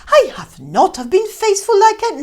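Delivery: loud, high, indignant denial; indignant protest. Acted. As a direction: protest hard, stung and defensive. Scrooge denies another lover.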